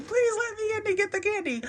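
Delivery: dog's plea. Retold or imitated. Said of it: "He's like".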